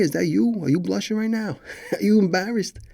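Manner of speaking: ordinary delivery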